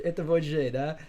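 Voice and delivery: normal talking voice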